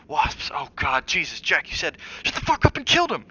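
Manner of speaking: loud, high, rising panic; wife screams at husband in panic; panicked shouting. A narrator's reading. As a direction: scream it in a frenzy. Panicked shouting during the attack.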